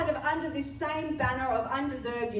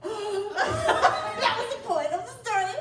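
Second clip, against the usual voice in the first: laughing through the words, slow and higher; 9 semitones higher, 4 dB louder, slower than usual. Real, not acted. Preacher laughing at her own story's punchline, laughing. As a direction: giggle it out, tickled by the twist.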